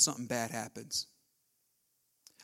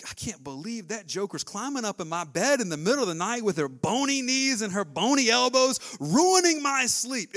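louder, mock-grumbling about the kid in bed; griping complaint; 6 semitones higher, 9 dB louder than usual. Tired mom complaining about kid in her bed, mock grumble. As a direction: whine it like a tired, put-out parent. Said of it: "I didn't say once".

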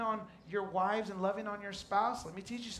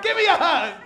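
playful demand; 9 semitones higher, 14 dB louder than usual. Retold or imitated. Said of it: "I came walking just like this".

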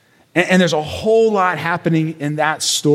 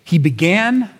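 usual speaking voice

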